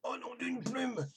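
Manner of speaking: a touch of a sore-throated rooster; sick little rooster. A narrator's reading. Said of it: "constata le petit coq".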